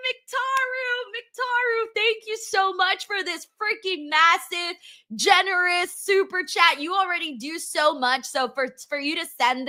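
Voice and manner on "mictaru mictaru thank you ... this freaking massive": excited high call-out. Real, not acted. Thanking a big donor.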